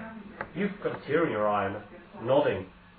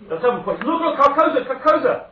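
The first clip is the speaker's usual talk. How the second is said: much higher and louder, calling out the sight; excited cry; 12 semitones higher, 10 dB louder than usual. Acted. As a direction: shout Carcosa with wonder and alarm. Priest points at the horizon.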